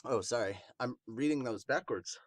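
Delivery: ordinary talk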